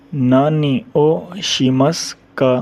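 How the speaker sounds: नानी ओ शिमस का spelled out slowly, syllable by syllable; slow demo. Shown as a demonstration. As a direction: say नानी ओ Sheamus का slowly so students catch each part. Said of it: "teacher models question slowly". (नानी ओ Sheamus का धीरे-धीरे, हर शब्द अलग)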